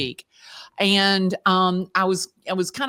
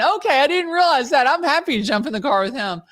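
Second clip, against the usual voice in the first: high, loud "oh" of dawning recognition, then eager backpedalling; hammed-up surprise, pitched way up; mock sudden realisation; 7 semitones higher, 3 dB louder than usual. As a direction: replay an embarrassed, delighted change of heart. Acts out sudden realisation about Jason.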